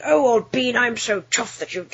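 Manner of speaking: put-on Monty Python-esque posh British accent; mock posh British. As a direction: spoof a posh British toff. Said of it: "Monty Python-esque sounding posh British".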